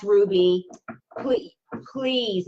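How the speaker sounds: ordinary talk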